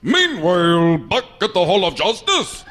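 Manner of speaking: booming cartoon announcer. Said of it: "the greatest announcer of all time".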